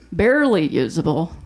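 normal talking voice

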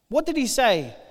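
ordinary talk

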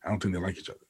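telling the wife softly during the movie; hushed aside to wife. Retold or imitated. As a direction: whisper the excuse to your wife in the theatre. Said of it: "I was like".

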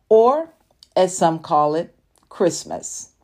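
ordinary talk

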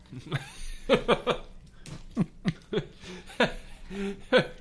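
high rising laugh. Real, not acted. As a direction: burst out laughing at the heat. Laughing at spicy heat.

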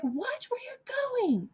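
very high, stretched-out scream at reduced volume; thin, drawn-out high yell; mock full-lung scream. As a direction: reenact screaming at a driver, held back. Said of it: "screamed at the top of my lungs".

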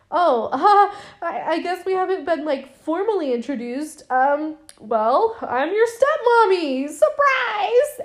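high, giggling, breathy-sweet reveal; giggly stepmom. Retold or imitated. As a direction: play the coy stepmom dropping the surprise. Stepmother introduces herself.

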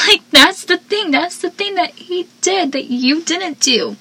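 incredulous, laughing emphasis; amused disbelief; exasperated. Real, not acted. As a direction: spell it out to him. Exasperated commentary.

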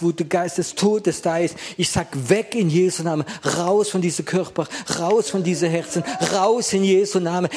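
loud, commanding deliverance prayer; preaching at full force. Acted. Pastor commanding the spirit of fear to leave, forceful. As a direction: drive the spirit out with every line.

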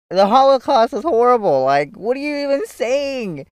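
appalled, scolding him; outraged retort. Retold or imitated. Retelling outrage at brother.

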